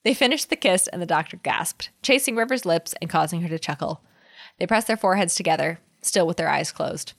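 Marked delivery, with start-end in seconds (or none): none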